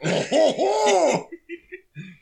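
high, drawn-out cartoon laugh; Blotto. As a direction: imitate a silly character's laugh. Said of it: "when he laughs".

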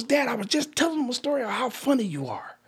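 high-pitched wounded teenager, retold; hurt son. Son explaining to dad why he was embarrassed, hurt. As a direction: plead your case high, like a hurt son. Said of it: "you ripped into me and embarrassed me".

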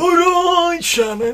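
loud, high, hammed-up Yorkshire greeting; Yorkshire accent. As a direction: bellow a Yorkshire hello to Sharon. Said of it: "That's a Yorkshire accent".

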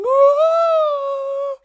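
long, very high held wail; hammed-up loud soprano "ah"; mock opera-singer cry. Imitating the opera singer during sex, mock high wail. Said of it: "the opera singer's going".

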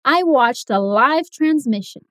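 slow, live with a long i; I watched a live transmission, live like five; slow model sentence. Shown as a demonstration.

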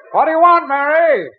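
calling out to his wife downstairs. Acted. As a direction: shout it down the stairs. Calling down from upstairs.

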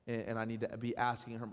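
ordinary talk, nothing marked

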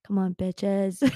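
usual speaking voice

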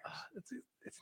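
playful hushed whisper; library whisper. Pretend. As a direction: whisper as if someone might hear. Joining the whispered bit.

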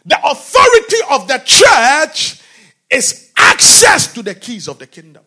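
loud, high pulpit declaration; preacher proclaims the church's authority to the congregation, shouting; preaching shout. Real, not acted. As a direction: drive each phrase home.

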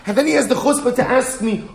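high, laughing indignation; up in arms. Retold or imitated. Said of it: "he's all up in arms".

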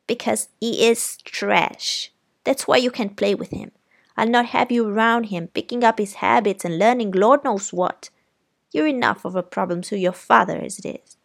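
contemptuous aunt. Acted. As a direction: spit out the judgement. Aunt condemns Walter as trash.

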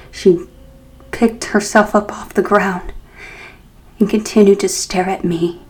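usual speaking voice

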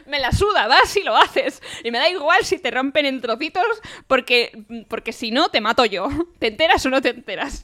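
loud, laughing angry threat; angry shout. Retold or imitated. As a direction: bark the threat half laughing. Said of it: "le dice".

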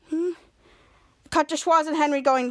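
usual speaking voice

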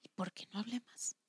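breathy whispered self-reproach; hushed inner voice asking why. Retold or imitated. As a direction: mutter it under your breath, regretful. Self-talk recalled after conversations.

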